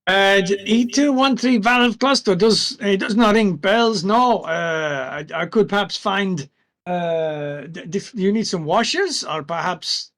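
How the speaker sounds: shopkeeper voice. Acted. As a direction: play the shopkeeper unsure, reaching for ideas.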